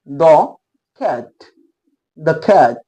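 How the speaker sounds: द cat, "द" short and light, not दी; "the cat" demo. Shown as a demonstration. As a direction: model द cat plainly for the class. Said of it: "दी cat नहीं पढ़ेंगे". ("द cat", छोटा "द")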